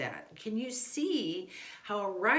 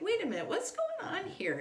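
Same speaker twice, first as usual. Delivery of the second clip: sudden realization; 13 semitones higher than usual. Retold or imitated. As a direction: pitch jumps much higher in sudden surprise. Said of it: "at some point I'm like".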